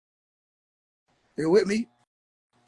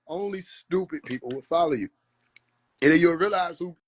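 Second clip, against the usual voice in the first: usual speaking voice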